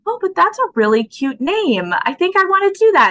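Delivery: delighted and quickening; excited retelling. Retold or imitated. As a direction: gush over the cute name. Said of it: "And I was like".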